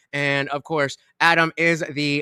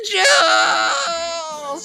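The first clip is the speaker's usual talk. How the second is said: shouting; 10 semitones higher than usual. Pretend. Streamer hollering at donation alert.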